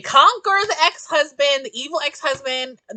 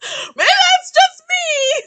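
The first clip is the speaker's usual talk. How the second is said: high laughing protest; 11 semitones higher, 8 dB louder than usual. Real, not acted. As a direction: laugh through it loudly. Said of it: "if I'm like".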